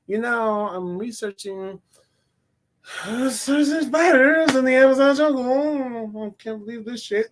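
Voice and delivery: mock the reluctant actress, dragging it out; reluctant, bored. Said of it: "she just really does not wanna be there".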